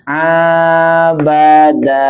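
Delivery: slow, stretched recitation of Abada; drawn-out reading. Shown as a demonstration. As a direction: draw out each vowel of Abada. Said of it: "Kalau ini kan panjang". (bacaan panjang pelan)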